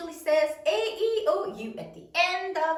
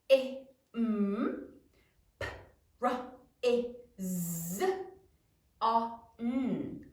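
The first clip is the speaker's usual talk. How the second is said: imprison stretched slowly for spelling; slow model word for third graders; slow sounding out; 3 dB quieter, slower than usual. Shown as a demonstration. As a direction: draw it out slowly as you sound it. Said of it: "Now say it as you write it".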